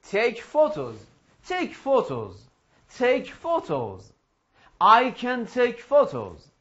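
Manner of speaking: slow, measured repeat-after-me phrases; slow drill model. Shown as a demonstration. Teacher drills photo phrases with the class, slow model. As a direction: stretch each sentence out for learners.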